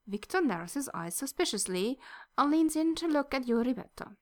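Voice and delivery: plain reading voice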